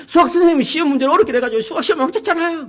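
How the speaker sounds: put-on high, whining complaint from a sulky teen; a son grumbling about his maths teacher; whiny teenage son. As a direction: whine it like a boy blaming his teacher. Son complains, voiced by father.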